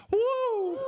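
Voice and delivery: high whooping woo; woo release. Shown as a demonstration. Teacher demonstrating the release call, high woo. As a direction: let it all out upward. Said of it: "Five is a woo-sa".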